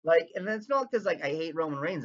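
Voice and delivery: ordinary talk, nothing marked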